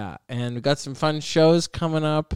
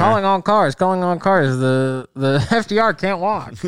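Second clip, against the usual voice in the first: put-on high, loud old-time radio call; hammed-up newsreel radio announcer; old radio dispatch; 6 semitones higher, 5 dB louder than usual. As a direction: bark it like a vintage police dispatcher. Mock radio broadcast alarm.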